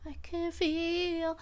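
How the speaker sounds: slow, high, drowsy stretched words; sleepy drawl. Real, not acted. Streamer to chat, sleepily drawling. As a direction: let the sleep drag every word out.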